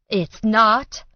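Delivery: loud, bitter retort. A narrator's reading. Mother bitterly to daughter, loud. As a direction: throw the word back bitterly. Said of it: "her mother said bitterly".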